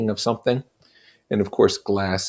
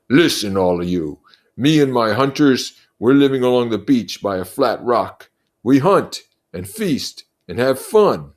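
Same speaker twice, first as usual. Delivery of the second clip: Jack shouting; 6 semitones higher, 4 dB louder than usual. Acted. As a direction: address the whole crowd like a chief. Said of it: "began to shout".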